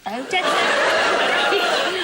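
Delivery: slow, drawn-out mocking repeat, laughing; teasing echo. Real, not acted. Host teasing Karen about her words, drawn out. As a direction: savour each word to tease her.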